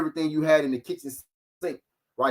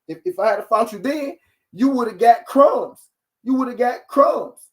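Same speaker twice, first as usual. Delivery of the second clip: higher, louder and slow on crumbs; mock boastful quote; 7 semitones higher, 5 dB louder than usual. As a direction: drag out crumbs like a smug boast. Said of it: "quote of Thurman".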